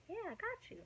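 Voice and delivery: high, bright reassurance to the ex; eager yes. Retold or imitated. Promising the ex she'll stay up, eager and high.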